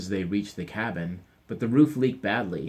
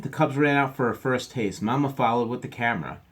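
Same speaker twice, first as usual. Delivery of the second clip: lively read-aloud; 5 semitones higher, 4 dB louder than usual. A narrator's reading. Reading to children, lively.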